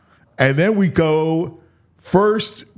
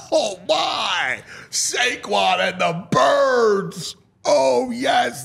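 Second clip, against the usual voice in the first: higher, drawn-out thrilled outcry; delighted cry; 7 semitones higher, slower than usual. Real, not acted. Host cheering Saquon Barkley's return game, delighted outcry.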